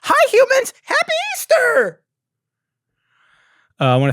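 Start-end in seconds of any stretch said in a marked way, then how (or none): 0.0-2.0 very high, loud squeaky greeting; mock the alien's chirpy hello; alien greeting impression. Host wishing he'd opened the show that way, very high squeaky greeting. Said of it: "I should have started the episode like that".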